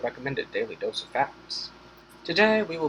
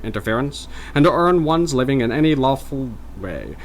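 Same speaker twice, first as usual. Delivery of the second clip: formal Mid-Atlantic reading; louder Mid-Atlantic proclamation; Mid-Atlantic accent; 4 semitones higher, 5 dB louder than usual. Acted. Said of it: "mock Mid-Atlantic voice".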